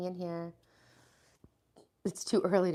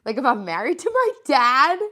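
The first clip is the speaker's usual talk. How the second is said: rising, louder voice full of worry; half-joking dream panic, louder and higher; alarmed second thoughts; 4 semitones higher, 11 dB louder than usual. Retold or imitated. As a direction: panic as the doubts hit you. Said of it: "I'm like".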